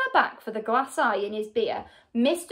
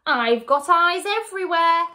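louder, higher, gloating cackle; cackling villainess; 5 semitones higher, 6 dB louder than usual. A narrator's reading. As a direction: crow it in triumph at your victim. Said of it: "cackled Missus Twit".

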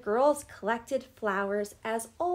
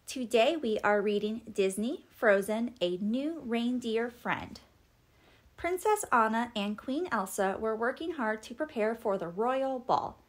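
usual speaking voice

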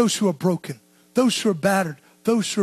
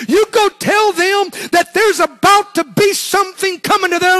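loud, higher, pounding each you go tell them; full-force preaching; 4 semitones higher, 4 dB louder than usual. Real, not acted. Preacher sending the church out to evangelize, full-force preaching. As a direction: drive it home like a revival climax.

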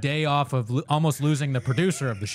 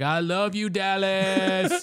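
higher, rising cheesy stage shout-out; mock comedian greeting; 6 semitones higher, much slower than usual. Mocking the comic greeting Ohio as Dallas, cheesy. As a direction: gush at the wrong city's crowd. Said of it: "He's like".

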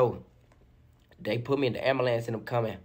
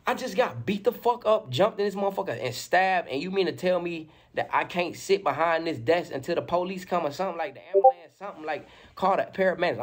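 higher-pitched, mounting disbelief; indignant protest; 6 semitones higher than usual. Retold or imitated. Injured man arguing with hotel staff for help, indignant. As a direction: retell an outraged plea for help.

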